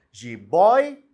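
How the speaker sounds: Jiboia said loud with a rise on the end; teacher calls out Jiboia loudly, lifting the last syllable; stressed word demo. Shown as a demonstration. Teacher showing students the old accented word Jiboia, said loud. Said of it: "desse jeito".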